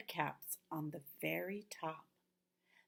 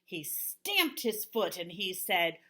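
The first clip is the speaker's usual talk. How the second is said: ordinary delivery, nothing marked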